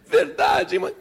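put-on high, pleading child voice; squeaky, hopeful girl; little girl. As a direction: ask like a child wanting reassurance. Tearful girl seeks reassurance.

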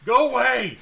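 loud, high shout of go away; shooing yell. Acted. Hidden local shouts the party away, loud and high. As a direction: drive them off with a yell.

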